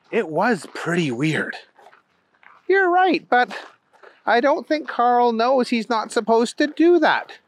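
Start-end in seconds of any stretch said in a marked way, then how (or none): 2.7-7.4 put-on high-pitched girl explaining; conceding little girl, pitched well up. Girl defends her dog.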